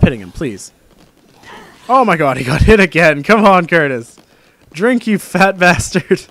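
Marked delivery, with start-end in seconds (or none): 1.9-6.2 yelling louder and higher at the screen; laughing shouts egging a buddy on; loud excited urging. Real, not acted. Gamer yelling at Curtis to drink, laughing and loud.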